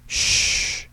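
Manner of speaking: long, sharp "shh"; irritated hushing sound; reprimanding shush. A narrator's reading. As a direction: shush a noisy group behind you. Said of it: "The reprimand came from the second row".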